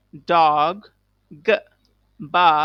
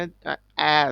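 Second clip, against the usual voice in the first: normal talking voice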